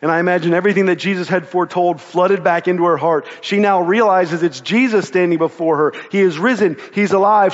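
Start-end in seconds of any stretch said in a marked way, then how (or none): none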